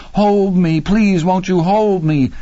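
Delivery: high, desperate begging; terrified pleading. A narrator's reading. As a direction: beg him in panic. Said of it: "Laverne screamed".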